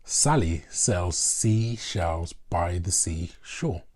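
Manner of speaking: slow, every S sound leaned on; slow alliteration demo. Shown as a demonstration. Teacher showing students alliteration, slow with each S stressed. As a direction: spell out each S so the pattern shows. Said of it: "Here's an example of alliteration".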